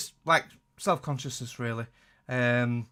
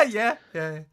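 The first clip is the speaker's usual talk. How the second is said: high laugh breaking into yeah; 8 semitones higher than usual. Real, not acted. Co-host cracking up at the duct-taped headset story, laughing. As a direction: laugh it out, much higher.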